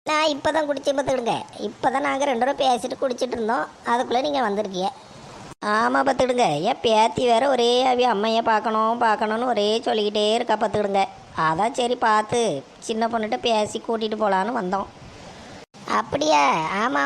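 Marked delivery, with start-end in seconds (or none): none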